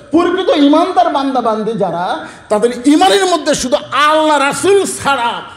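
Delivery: soaring preacher's declaration; impassioned sermon. Acted. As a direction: proclaim it high to the congregation. Preaches the climax of the story.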